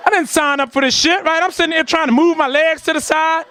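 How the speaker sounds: ordinary talk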